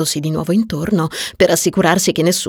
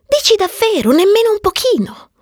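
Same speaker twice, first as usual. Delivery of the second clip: high-pitched, disbelieving questions; high voice of a doubting friend; incredulous probing; 14 semitones higher than usual. Acted. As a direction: probe in a high, girlish pitch. Marion probing her friend in disbelief.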